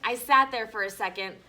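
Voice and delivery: ordinary delivery, nothing marked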